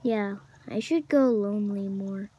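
usual speaking voice

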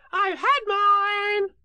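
very high, loud, drawn-out little Roo; proud. A narrator's reading. Roo boasts about taking medicine, proudly. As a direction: pipe up high, slowly boasting. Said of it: "Roo said proudly".

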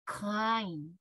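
"kind" as one drawn-out English syllable; English "kind", final d barely released; English pronunciation. Shown as a demonstration. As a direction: say "kind" with no vowel after the d. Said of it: "と発音します".

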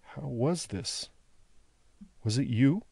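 softly and slowly, lifting to a question on YOU; hesitant prisoner finding his words; slow, halting. Acted. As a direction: grope for it, word by word. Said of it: "though slowly".

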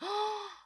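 sharp, high gasp of delight. Real, not acted. Spotting a cute ruler in the box, a high delighted gasp. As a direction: catch your breath at something cute.

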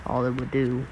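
low, soft menacing killer voice; slasher killer. Acted. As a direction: murmur it like a calm psycho. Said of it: "Why am I talking like a serial killer?".

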